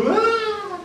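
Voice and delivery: long, very high wail; mock falling scream. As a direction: scream as if dropping from a height, hammed up. Acts falling off a building.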